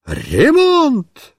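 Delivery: high, slow, indignant exclaim. A narrator's reading. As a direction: cry out the news of the disaster. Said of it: "воскликнула Марья Петровна".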